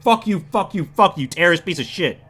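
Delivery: high, loud acted rage; pounding, repeated angry outburst; furious cursing. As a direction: hurl curses while kicking someone. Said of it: "she proceeds to start kicking this guy".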